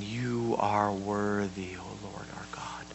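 slow, then whispered reverence; slow whispered prayer; hushed worship. Acted. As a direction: breathe the words in awe. Reading worship quietly.

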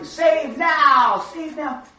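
high crowd shout, easing on the repeat. Acted. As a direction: yell it out like a clamoring crowd. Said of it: "when the people are shouting".

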